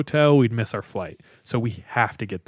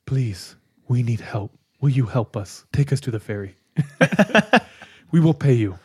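laying it on thick. Retold or imitated. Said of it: "lays it on thick".

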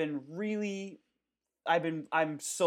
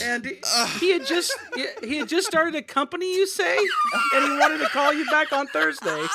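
loud, breathless after laughter; after laughing hard; 14 semitones higher, 12 dB louder than usual. Real, not acted. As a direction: push through the giggles. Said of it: "Oh, cheeks hurting".

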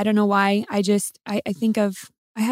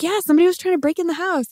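higher and fast urgent insistence; breathless kid; 7 semitones higher, faster than usual. Retold or imitated. Wife telling her mom about the break-in, high and rushed. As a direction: rush it out like a scared kid. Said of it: "I'm like".

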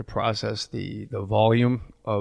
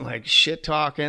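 ordinary talk

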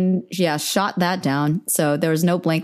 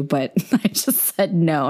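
ordinary talk, nothing marked

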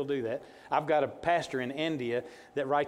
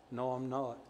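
put-on flat deadpan kid voice; bad acting; 5 dB quieter than usual. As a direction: say it with no emotion at all. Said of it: "no emotion whatsoever".

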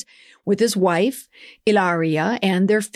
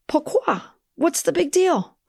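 high, bemused French-flavoured shrug; mock puzzled Frenchwoman; 10 semitones higher than usual. Host voicing her own shrugging reaction, higher and amused. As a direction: toss it off like a puzzled Parisian. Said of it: "If your reaction, like mine, was".

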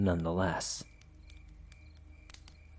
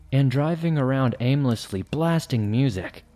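plain reading voice

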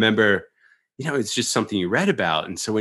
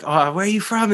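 eager excited greeting; 9 semitones higher than usual. Retold or imitated. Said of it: "and then I'd be like".

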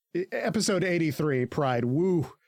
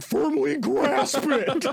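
high, slow, loud meme voice; exaggerated meme voice, laughing; Squidward meme; 12 semitones higher, 4 dB louder, much slower than usual. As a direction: bellow it slowly, way up. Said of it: "meme of Squidward".

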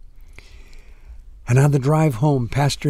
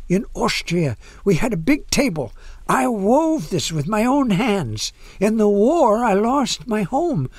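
put-on higher, lilting Austrian-accented woman; accented English; 7 semitones higher than usual. A narrator's reading. Old woman recalls wartime loss to pastor, Austrian accent. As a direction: tell it in an Austrian accent, pitched up. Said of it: "in accented English".

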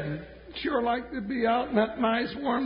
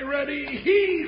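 heave ho pulling. Acted. Heaving beef at door.

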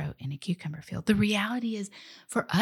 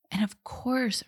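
ordinary talk, nothing marked

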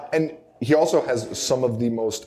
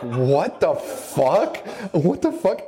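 stunned disbelief; 5 semitones higher, much slower than usual. Retold or imitated. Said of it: "And I'm like".

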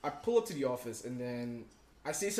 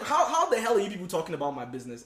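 high, loud disbelief; exasperated outburst; 5 semitones higher, 7 dB louder than usual. Retold or imitated. To gossiping coworkers, loud exasperation. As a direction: fire it back, fed up. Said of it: "I'm just like".

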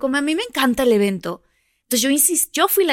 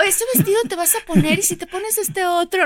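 imitating mom; 10 semitones higher than usual. Imitating mom's hints.

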